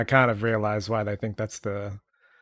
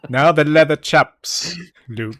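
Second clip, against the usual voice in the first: mock Obi-Wan; 4 semitones higher, 6 dB louder, slower than usual. Parody Obi-Wan bit.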